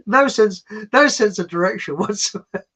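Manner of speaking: usual speaking voice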